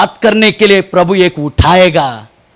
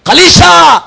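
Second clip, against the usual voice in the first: high, loud, stretched preacher's cry; full-force preaching; 10 semitones higher, 5 dB louder than usual. Acted. Preacher rousing the church about sin, full force. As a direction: thunder it at the congregation. Preaching God's covenant with Phinehas.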